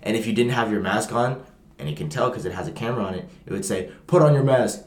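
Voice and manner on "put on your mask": stiff, mechanical command, a bit louder; robotic scary voice. Retold or imitated. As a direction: order it like a cold machine. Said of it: "in, like, a really robotic, scary voice".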